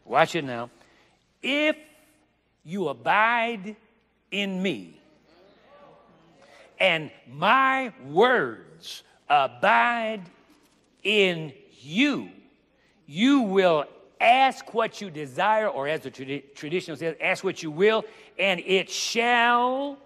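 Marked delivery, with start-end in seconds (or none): 1.4-15.7 slow, loud, drawn-out proclamation of the verse; preaching at full force. Acted. Preacher to congregation, booming slow scripture proclamation. 17.2-20.0 louder, with a long stretched "shall"; preaching proclamation. Acted. Preacher to congregation, stretched promise proclaimed.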